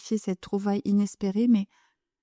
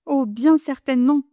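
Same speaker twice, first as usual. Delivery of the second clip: terrified; 5 semitones higher, 5 dB louder than usual. A narrator's reading. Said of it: "avec terreur".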